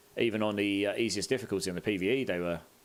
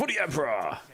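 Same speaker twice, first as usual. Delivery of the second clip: gruff barked parade orders; 5 semitones higher than usual. Retold or imitated. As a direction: roar it for the Emperor. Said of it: "how they all sound when they're in combat".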